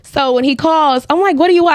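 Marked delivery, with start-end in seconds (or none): 1.4-1.8 louder, higher curt demand; irritated phone brush-off; rude attitude. Retold or imitated. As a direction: snap it at an unwanted caller. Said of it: "I have this attitude".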